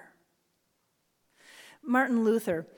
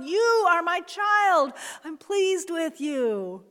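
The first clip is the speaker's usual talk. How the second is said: loud slow shout, pitched higher; a booming slow blessing, higher and louder; 6 semitones higher, 8 dB louder, slower than usual. Acted. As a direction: proclaim "You are my child" at full voice. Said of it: "sometimes he's shouting".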